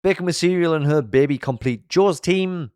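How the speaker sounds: ordinary delivery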